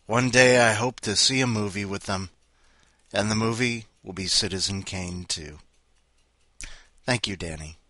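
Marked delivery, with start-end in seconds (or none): none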